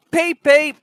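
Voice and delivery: high, loud engine whistle peeps. A narrator's reading. Thomas answering the guard, whistling peep peep. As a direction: chirp it like a little steam whistle. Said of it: "answered Thomas".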